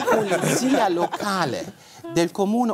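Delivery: reading the letter through real laughter; giggling through the official Italian; laughing while reading. Real, not acted. Reading the police letter aloud to the host, laughing.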